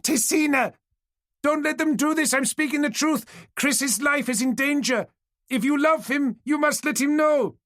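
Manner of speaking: very high, loud desperate pleading; shouting over the crowd. A narrator's reading. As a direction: shout above everyone to be heard. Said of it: "Kantara had to raise her voice".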